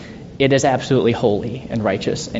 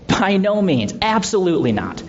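high, forceful denial; emphatic no way; 7 semitones higher than usual. Acted. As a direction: insist hard that it cannot be. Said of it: "very emphatic".

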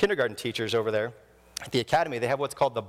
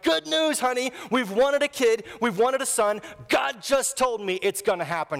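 jumping high on good news, then easing down; overjoyed husband; 9 semitones higher than usual. Pretend. Abram telling his wife Sarai the news, thrilled.

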